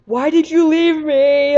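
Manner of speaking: a haunted pet asking why, drawn out; creepy Pokemon voice. Retold or imitated. Fire the Cyndaquil pleading with his trainer, slow and eerie. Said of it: "And Fire was like".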